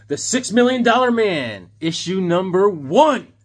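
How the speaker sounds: loud, drawn-out title announcement; big showy reveal of the title, punching the last word; announcer flourish. Pretend. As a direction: stretch it out like a show opener.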